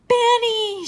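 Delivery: high, loud, drawn-out exclamation; shocked cry. A narrator's reading. Jessie catches Benny cutting the dog's hair, shocked cry. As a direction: cry out the name in alarm. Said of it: "she cried".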